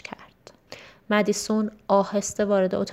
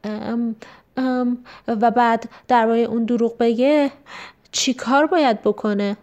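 put-on hesitant child, louder through the middle; trembling voice; 3 semitones higher, 5 dB louder than usual. A narrator's reading. Guilty daughter sounds out her father, nervous and trembling. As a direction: stall on the ums, nervously working up to the question. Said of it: "با صدای لرزانی گفت".

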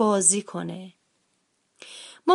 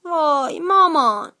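slow sighing whine, higher and louder; 7 semitones higher, 5 dB louder, slower than usual. A narrator's reading. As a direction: drag it out with a sigh. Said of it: "آهی کشید و گفت".

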